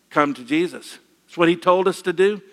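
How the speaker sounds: ordinary talk, nothing marked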